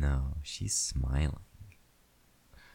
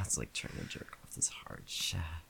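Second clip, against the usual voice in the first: whispering; 7 dB quieter than usual. Acted. Intimate whispered roleplay.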